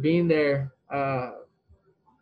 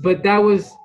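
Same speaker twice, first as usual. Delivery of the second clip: normal talking voice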